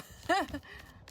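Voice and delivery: light breathy chuckle; short laugh. Real, not acted. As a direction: chuckle at your own remark. Laughs at own cloud remark.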